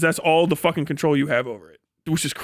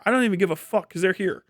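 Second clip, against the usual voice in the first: ordinary delivery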